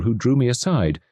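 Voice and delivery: ordinary delivery, nothing marked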